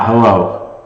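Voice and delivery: vintage echo chamber, boomy room. Real, not acted. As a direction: speak into an echoey room. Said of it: "The audio is terrible".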